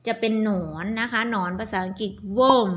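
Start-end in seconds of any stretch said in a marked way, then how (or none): 2.4-2.7 "worm" with the vowel เออ, not วอร์ม; pronunciation demo. Shown as a demonstration. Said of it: "ออกเหมือนวอร์ม แต่ไม่วอร์ม worm นะคะ สระเออ". (ออกเสียง "worm" เป็นสระเออ ไม่ใช่วอร์ม)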